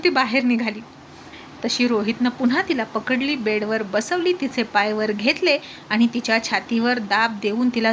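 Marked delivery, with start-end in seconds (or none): none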